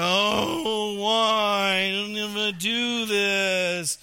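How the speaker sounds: hammed-up whining child, drawn out; whiny kid. Billy whining when told to empty the dishwasher. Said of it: "They go".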